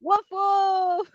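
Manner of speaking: high bright woof woof; playful dog woof. Retold or imitated. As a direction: bark like a friendly dog. Teacher imitates dog bark.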